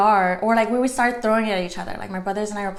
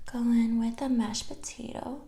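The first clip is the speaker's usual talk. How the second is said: soft whispered aside over the food; mukbang whisper; 6 dB quieter than usual. Real, not acted. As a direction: murmur it close to the mic, unvoiced. Quiet aside while eating.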